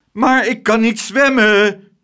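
high, loud, drawn-out whine; slow, plaintive howl; wailing. A narrator's reading. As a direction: wail it in helpless dismay. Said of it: "jammerde meneer Tump".

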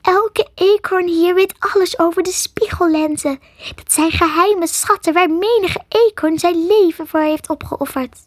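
high, self-important little creature voice; small animal making a grand confession; dramatic squirrel. A narrator's reading. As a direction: reveal the secret with squeaky melodrama.

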